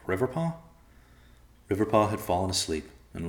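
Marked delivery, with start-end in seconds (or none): 0.1-0.5 high questioning call. A narrator's reading. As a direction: call his name, puzzled. Calling sleeping cousin.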